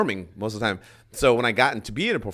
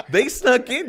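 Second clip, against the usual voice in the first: loud, high incredulous question; high-pitched, outraged double take; shocked disbelief; 14 semitones higher, 8 dB louder, slower than usual. Retold or imitated. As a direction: echo it in acted shock, pitch shooting up. Comedian repeats it in disbelief.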